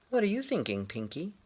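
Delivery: usual speaking voice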